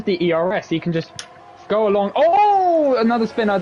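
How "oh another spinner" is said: sudden high yelp of surprise; surprised. Real, not acted. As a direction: react to a surprise spin. Sudden spin in the race.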